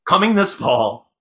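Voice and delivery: slow, loud, high opening tagline; mock trailer announcer. Host pitching a Santa vampire movie, trailer announcer.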